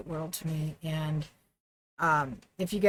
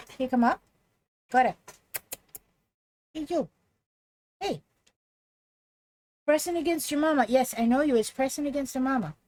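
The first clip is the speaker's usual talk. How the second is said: higher, sing-song pet talk; 5 semitones higher, faster than usual. Real, not acted. Streamer coaxing her dog up for hugs, higher doggy baby talk.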